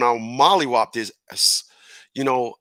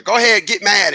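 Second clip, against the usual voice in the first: louder, higher goading shout; egging on; 6 semitones higher, 4 dB louder than usual. Acted. Two emotions egging each other on, goading. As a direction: goad them loudly into it. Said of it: "they egg on each other".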